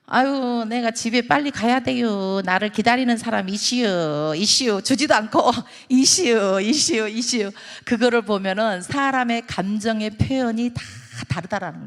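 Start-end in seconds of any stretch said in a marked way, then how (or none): 0.1-4.8 drawling Chungcheong dialect with stretched endings; Chungcheong granny. Acted. Chungcheong granny avoiding a straight answer, drawling dialect. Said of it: "충청도 할매가". 5.9-7.5 repeated drawled Chungcheong endings; Chungcheong granny. Acted. Chungcheong granny hinting someone waits, drawling dialect. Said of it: "충청도 할매가".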